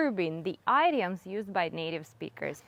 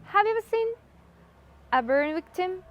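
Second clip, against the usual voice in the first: high 'Have you ever seen', pause, 'a burn victim'; thought groups; 8 semitones higher, faster than usual. Shown as a demonstration. Teacher showing native grouping, two raised chunks with a pause. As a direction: split it into two chunks after 'seen'. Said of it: "group it like this".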